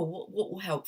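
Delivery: usual speaking voice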